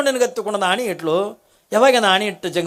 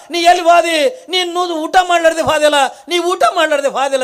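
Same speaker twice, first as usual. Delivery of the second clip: desperate calling out; 8 semitones higher than usual. Acted. Kalavve calling after the vanished holy man, shouting. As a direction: cry out into the empty house.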